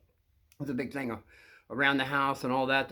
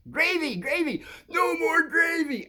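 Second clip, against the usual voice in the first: much higher, slow cartoon voice; cartoon catchphrase; 3 dB louder, slower than usual. Quoting the cartoon to the kids, high and slow. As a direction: squeal it out like the cartoon character.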